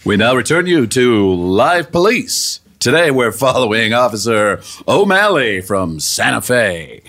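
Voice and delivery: slow, low announcer voice; slow, deep TV-show narration; old radio announcer. Acted. Announces the Live Police show.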